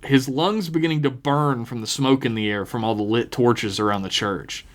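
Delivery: ordinary delivery, nothing marked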